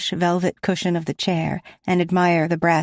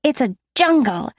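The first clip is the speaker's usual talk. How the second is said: put-on high, bright child's exclamation; excited exclaim; 9 semitones higher, 4 dB louder than usual. A narrator's reading. Sasha telling Talia about the greenhouse, excited high exclaim. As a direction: exclaim it like a thrilled little girl. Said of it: "Sasha exclaims".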